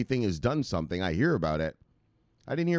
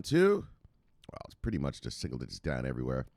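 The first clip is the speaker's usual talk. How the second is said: usual speaking voice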